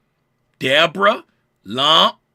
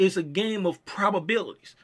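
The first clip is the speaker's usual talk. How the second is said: ordinary delivery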